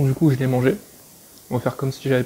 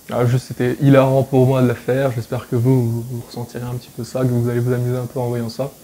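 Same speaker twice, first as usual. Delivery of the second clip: ordinary delivery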